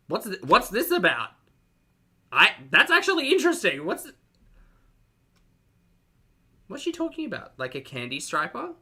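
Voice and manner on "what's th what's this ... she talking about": high-pitched incredulous questioning; baffled demand. Real, not acted. Host puzzled by the video she is watching, high and baffled. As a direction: demand an explanation, voice climbing high.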